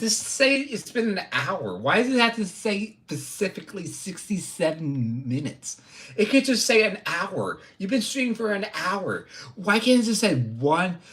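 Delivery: high-pitched exasperated rant; raging. Real, not acted. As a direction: complain in a worked-up, high voice. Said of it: "I'm raging over the live numbers".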